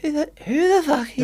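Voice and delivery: high, laughing mock outrage; comic retold indignation; indignant. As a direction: protest incredulously through laughter. Retells outrage at girl mocking daughter.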